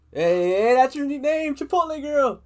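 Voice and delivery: loud, high playful teasing. Real, not acted. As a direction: call out the nickname gleefully. Teasing calls friend new nickname.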